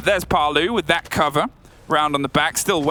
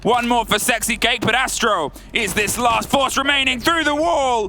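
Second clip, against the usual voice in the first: esports caster; 8 semitones higher than usual. Acted. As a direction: scream the clutch like a caster. Clutch round climax call.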